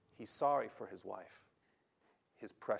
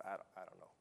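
defeated husband; 5 semitones lower, 16 dB quieter than usual. Retold or imitated. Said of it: "He says".

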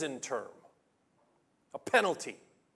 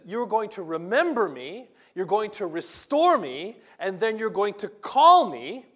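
louder and higher with each phrase, climbing to call me; impassioned proclamation; 6 semitones higher, 12 dB louder than usual. Acted. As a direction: preach Job's hope at full force, building. Preacher voicing Job's hope, building.

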